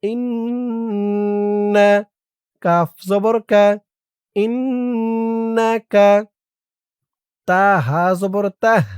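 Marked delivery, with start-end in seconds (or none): 0.0-2.0 ইন্না held long with nasal hum; ইন্না, long nasalised nn; drawn-out nasal. Shown as a demonstration. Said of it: "spelling then joined word". 4.4-6.2 drawn-out, melodic dua chanting; slow Quranic-style recitation of a prayer; slow Arabic recitation. Shown as a demonstration. Recites joined word.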